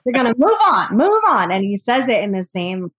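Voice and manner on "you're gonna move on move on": higher, louder mock of a firm fatherly cadence; imitating brother's advice. Sister mimicking her brother's fatherly advice, a mock firm, raised voice. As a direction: parrot his insistent "move on" with a laugh in it. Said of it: "cadence that my dad does".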